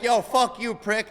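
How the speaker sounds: hammed-up insulted caller; mock rude client. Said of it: "They hear me, they're like".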